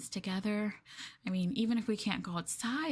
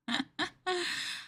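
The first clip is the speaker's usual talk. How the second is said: giggle; 8 semitones higher than usual. Acted. Giggling at captive partner.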